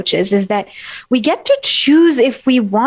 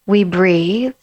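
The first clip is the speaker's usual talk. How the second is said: slow calm pause; 2 semitones lower, much slower than usual. Shown as a demonstration. Coach guiding listeners into a calm pause. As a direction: let each phrase breathe. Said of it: "there's a different energy".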